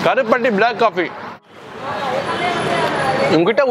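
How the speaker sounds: chewing while talking; speaking between bites; mouth full of food. Real, not acted. Seen 0: Spoons sundal into mouth at 1.5-2.2s, then speaks while chewing.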